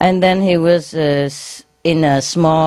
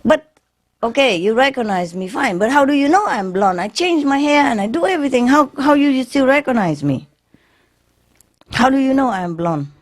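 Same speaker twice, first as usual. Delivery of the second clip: higher, exasperated pleading; frustrated; 8 semitones higher than usual. Acted. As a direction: push the question up in disbelief. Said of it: "So she was so frustrated".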